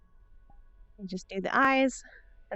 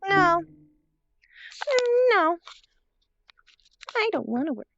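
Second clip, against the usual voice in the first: high whiny protest; whiny computer voice; 11 semitones higher, 7 dB louder than usual. Retold or imitated. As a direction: pout it out like a stubborn machine. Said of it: "things are just like".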